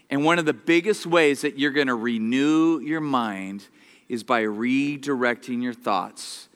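normal talking voice

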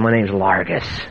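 low, slow demonic voice. Retold or imitated. Preacher imitating the demon answering him, low demonic voice. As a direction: imitate a demon slowly naming itself. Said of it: "his voice came out and said".

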